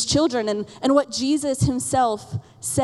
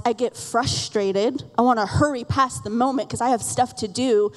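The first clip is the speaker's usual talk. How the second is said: ordinary delivery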